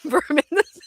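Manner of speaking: high, breaking into real laughter; laughing while talking. Real, not acted. Storyteller admitting she broomed the sand, cracking up. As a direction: lose it laughing mid-phrase.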